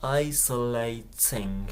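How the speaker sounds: Isolating with a crisp, fully released T, slowly; Isolating said slowly with a clean hard T; clear British T. Shown as a demonstration. Teacher showing the British T, slow and crisp. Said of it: "しっかりとTを発音する".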